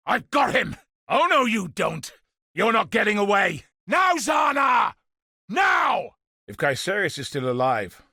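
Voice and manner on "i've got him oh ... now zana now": high shouted cries while holding him; urgent shouts of a fighter; straining battle shouts. Acted. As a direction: yell through the struggle. Grappling foe, calling to Zana.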